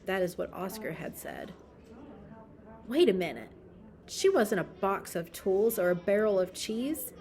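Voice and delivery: usual speaking voice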